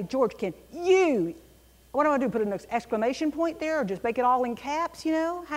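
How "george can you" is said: "you" drawn out and louder after "George, can"; slow down and land hard on "you"; stress on you. Shown as a demonstration. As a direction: hold "you" long and loud. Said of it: "if I say".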